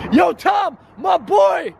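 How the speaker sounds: excited call. Real, not acted. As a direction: shout his name with joy. Greeting friend loudly, very high.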